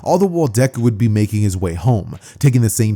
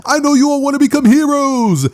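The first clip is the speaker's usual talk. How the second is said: very high, excited teacher voice; rally the room in a high cry; hyped-up announcement; 3 dB louder than usual. Acted. Teacher throwing papers up before the class, excited.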